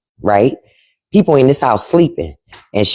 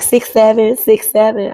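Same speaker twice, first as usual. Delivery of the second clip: put-on high, slow sing-out like a small child; drawn-out, high-pitched child impression; imitated little niece; 8 semitones higher, slower than usual. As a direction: mimic a little kid proudly chanting. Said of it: "So now she like".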